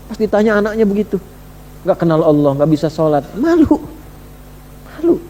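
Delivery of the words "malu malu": ashamed. Pretend. Preacher to fathers, picturing meeting God, high ashamed cry. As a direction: wince it out in embarrassment.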